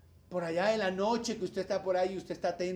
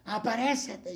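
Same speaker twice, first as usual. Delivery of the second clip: loud, drawn-out, higher summons; mock summoning into the dark; mock genie summoning; 4 semitones higher, 6 dB louder than usual. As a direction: call out as if conjuring a genie, hammed-up. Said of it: "así como si fuera Aladino el Señor".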